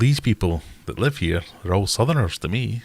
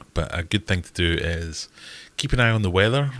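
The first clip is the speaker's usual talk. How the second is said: ordinary delivery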